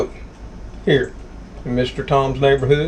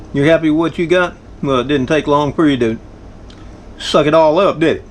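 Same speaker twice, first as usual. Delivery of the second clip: ordinary talk, nothing marked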